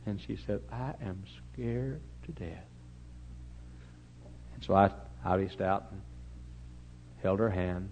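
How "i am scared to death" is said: very soft, slow, lightly acted fear; mock frightened confession. Frightened old lady's confession voiced.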